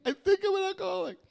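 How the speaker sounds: very high, squeaked mock wail; comically high-pitched admission; mock squeaky confession. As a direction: squeal it like a pitiful little confession. Mocks own confession again.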